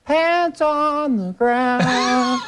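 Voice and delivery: put-on very high, very slow [name] warble; a mournful high [name] croon; [name] impression. Host demos his [name] version of [name], high and slow. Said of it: "a beautiful [name] version".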